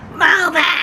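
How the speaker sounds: sharp, high yelled order; mock yell. Rude lady yelling at her on the deck, loud mock order. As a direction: bark it like a rude stranger. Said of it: "the lady yells at me".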